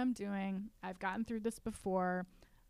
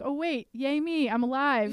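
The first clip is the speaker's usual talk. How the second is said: higher and louder, a sudden bright cheer; excited self-cheer; 5 semitones higher, 8 dB louder than usual. Retold or imitated. Reenacting her own reaction to blushing, delighted playful cheer.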